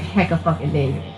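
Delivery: ordinary talk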